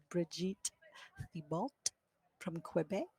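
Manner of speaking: names said softly with a French accent; a quick try at French pronunciation. Shown as a demonstration. Commentator trying a French accent on a Quebec player's name. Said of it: "I tried to do my French twang there".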